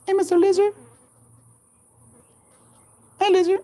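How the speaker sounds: sing-song cooing at a small animal; cutesy high voice. Real, not acted. Greeting a lizard sweetly.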